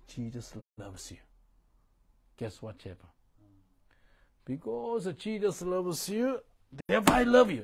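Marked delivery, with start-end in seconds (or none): 4.4-7.6 a ringing, full-voiced declaration; fervent preaching. Shown as a demonstration. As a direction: proclaim it like a street preacher. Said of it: "I speak like this".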